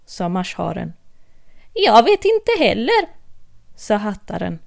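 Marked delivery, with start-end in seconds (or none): 1.7-3.2 high, louder, rising Hatter; cheerfully clueless Hatter; Mad Hatter. Acted. Hatter admitting he doesn't know, high and cheerful.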